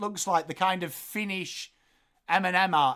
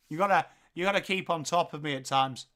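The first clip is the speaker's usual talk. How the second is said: ordinary talk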